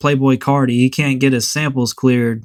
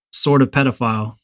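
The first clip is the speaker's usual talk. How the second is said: usual speaking voice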